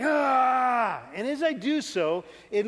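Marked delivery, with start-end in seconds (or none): 0.0-1.0 loud, raised cry of exertion; angry grunting yell. Retold or imitated. Angry boy shoving the bunk up with all his might, angry strained yell. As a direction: push it out in a burst of anger. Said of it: "I push up just angry".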